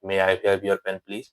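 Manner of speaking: soft, slow polite asks; polite requests. Shown as a demonstration. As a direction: say each gently with good manners. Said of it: "हमेशा इस तरीके से बोलना है". (धीमे, नरम और विनम्र ढंग से)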